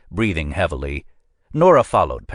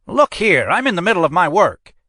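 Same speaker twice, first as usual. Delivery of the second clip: put-on much higher, loud, faster protesting old man; fussy old examiner; 13 semitones higher, 5 dB louder than usual. A narrator's reading. Examiner protests to the agent, high and fussy. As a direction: bristle at the interruption.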